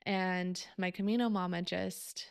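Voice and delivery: ordinary talk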